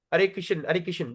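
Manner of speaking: Krishna run into Kishan, last A swallowed; North Indian shortening. Retold or imitated. Teacher imitates North Indian chanters, Krishna clipped to Kishan. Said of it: "North Indian used to do this".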